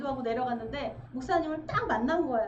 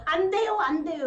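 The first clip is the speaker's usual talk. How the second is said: loud, higher cry of can't; desperate cry; 5 semitones higher, 9 dB louder than usual. Retold or imitated. Preacher voicing a struggling believer, loud higher cry. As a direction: cry it out like someone straining.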